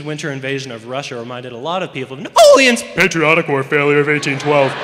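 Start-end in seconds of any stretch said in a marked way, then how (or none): 2.2-2.8 loud, high squawk on Napoleon's; acted adolescent voice crack. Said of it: "after the voice crack". 2.8-4.7 forced deep, loud cover-up voice; mock manly voice after a crack; lowered voice. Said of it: "they'll lower their voice after it".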